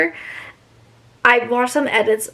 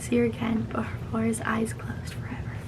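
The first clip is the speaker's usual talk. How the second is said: hushed reading; 6 dB quieter than usual. Acted. Reading the book's ending aloud, hushed.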